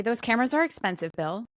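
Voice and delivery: usual speaking voice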